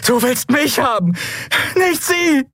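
high, desperate cry; hoarse-throated scream. A narrator's reading. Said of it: "schrie sie mit Wunderkehle".

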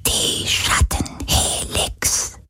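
slow, soft unvoiced whisper; understanding whisper. A narrator's reading. Sea spirit naming the Helix for Galen, in a knowing whisper. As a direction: whisper it as if you already knew. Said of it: "flüsterte der Geist des Meeres verstehend".

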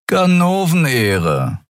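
slow, easy and unbothered; drawn-out laid-back reply; relaxed. Acted. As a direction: say it like it's obvious, no hurry. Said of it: "erklärte Riur entspannt".

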